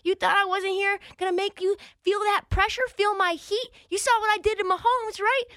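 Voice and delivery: high, trash-talking brag; boasting pass rusher. Retold or imitated. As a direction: taunt the quarterback, pitch up. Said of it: "and he's like".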